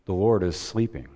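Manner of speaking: ordinary delivery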